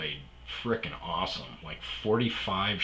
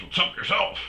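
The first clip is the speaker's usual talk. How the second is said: loud-ish, higher mock-gruff retort; gruff manager; 12 semitones higher, 4 dB louder, faster than usual. Manager answering a fired employee's greeting, mock-gruff. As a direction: imitate a gruff boss throwing it back. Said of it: "he's always really gruff".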